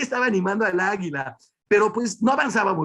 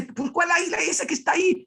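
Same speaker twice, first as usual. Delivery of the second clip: mock insistent shout; 10 semitones higher than usual. Friend insists on seeing the eagle.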